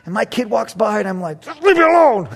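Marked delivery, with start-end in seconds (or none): 1.4-2.3 loud, higher irritated snap; mock snap. Dad snapping at his kid during football, irritated. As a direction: brush the kid off.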